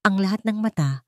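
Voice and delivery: usual speaking voice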